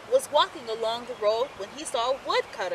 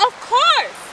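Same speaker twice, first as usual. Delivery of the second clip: much higher, louder builder; cheerful agreement; 12 semitones higher, 7 dB louder than usual. A narrator's reading. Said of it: "replied the builder".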